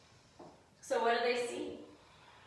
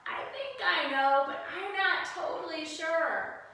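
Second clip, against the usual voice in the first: higher and louder, an unsure little cat; cat's voice; 5 semitones higher, 4 dB louder than usual. Acted. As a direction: rise up into a hesitant cat voice. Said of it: "Cat sees the lion and says".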